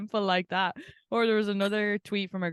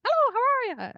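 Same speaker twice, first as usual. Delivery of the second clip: put-on very high, loud parroted Irish accent; exaggerated Irish accent, mocking and shrill-high; mimicked Irish accent; 5 dB louder, much faster than usual. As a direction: squawk back a mock Irish accent like a parrot. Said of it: "it's like they're a parrot".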